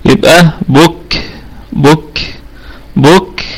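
"بك" said slowly, the ك ending in a faint puff; slow breathy k. Shown as a demonstration. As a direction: slowly let a little air out after the ك in "بك". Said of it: "شيء قليل جدًا يصاحب من الهواء". ("بك" ببطء مع نفَس خفيف)

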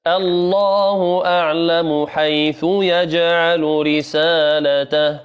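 slow, drawn-out chant; tajweed chant; Quran recitation. Acted. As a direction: recite slowly and melodically.

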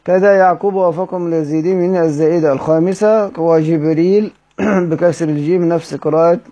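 ordinary talk

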